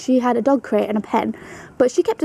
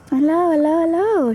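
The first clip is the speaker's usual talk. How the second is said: sweet greeting; 6 semitones higher than usual. Real, not acted. Greeting the rabbit fondly.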